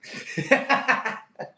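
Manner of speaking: airy wheezing giggle; breathless laugh. Real, not acted. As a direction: crack up silently at the joke. Still amused by the comment.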